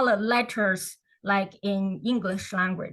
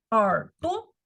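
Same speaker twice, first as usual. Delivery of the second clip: usual speaking voice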